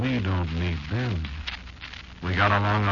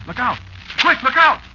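much higher, louder frantic warning; alarmed shout; 9 dB louder than usual. Acted. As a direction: cry out a sudden warning. Sudden alarm mid-story.

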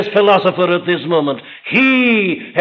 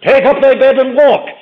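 commanding call; 7 semitones higher, 5 dB louder than usual. Acted. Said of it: "our Lord comes to him and says".

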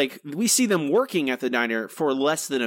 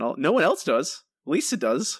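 normal talking voice

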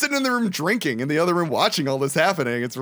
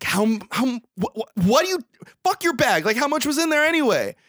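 higher, sputtering mock outrage; exasperated disbelief; 9 semitones higher than usual. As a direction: act a friend stammering, then snapping. Retells Mike reacting to lost drugs.

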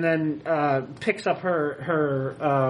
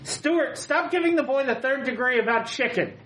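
from another room; 6 semitones higher than usual. Retold or imitated. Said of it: "you just hear from the other room".

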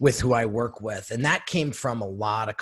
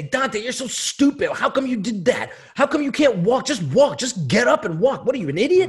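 mock scolding scream; 10 semitones higher, 4 dB louder than usual. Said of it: "did you scream at him and say".

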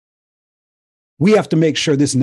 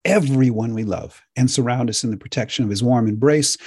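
closing blessing. Real, not acted. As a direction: bless the listeners gently. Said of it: "I'm gonna say".

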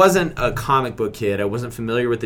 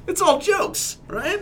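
nervous high squeak; slower than usual. Real, not acted. Nervous backpedal to Jay Courtney.

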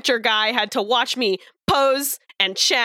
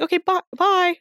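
much higher sing-song byes; sing-song goodbye; 10 semitones higher than usual. Retold or imitated. Waving goodbye to the guard, higher sing-song mock farewell. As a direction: wave them off cheerily like a mom. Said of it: "doing the mom wave to him".

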